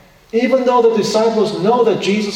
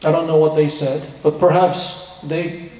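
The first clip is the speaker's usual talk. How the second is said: normal talking voice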